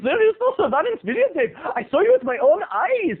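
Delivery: high, demanding mock Indian accent; hammy Indian-accented shop owner. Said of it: "giving this guy an Indian accent".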